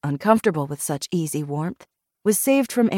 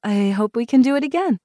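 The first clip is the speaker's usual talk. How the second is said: higher, tentatively hopeful; a little shy; 6 semitones higher than usual. A narrator's reading. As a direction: offer the hope a bit bashfully. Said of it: "a little shyly".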